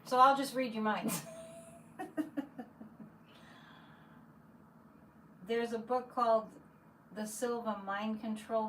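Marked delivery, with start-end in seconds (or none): 1.3-3.1 high, soft trailing chuckle; chuckling. Real, not acted. Streamer jokes she'll read viewers' minds, soft high chuckle. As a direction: laugh softly at your own joke.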